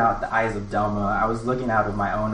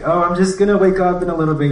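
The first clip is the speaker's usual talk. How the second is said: giddy reassurance; 8 semitones higher, 7 dB louder, faster than usual. Retold or imitated. Retold reply to friends, giddy.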